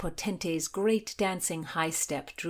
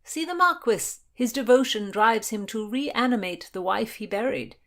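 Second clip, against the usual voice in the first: mocking; 6 semitones higher, 6 dB louder than usual. A narrator's reading. William mocking the lovesick Marquis.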